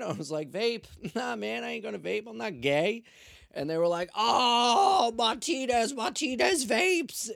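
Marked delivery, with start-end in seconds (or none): 0.5-3.0 high, loud incredulous comeback; mock scornful refusal. Arrestee joking back to cops about vaping, mock scornful refusal. 4.1-7.3 very high, loud drawn-out group taunt; jeering shout. Retold or imitated. Cops teasing their colleague Martinez, jeering shout.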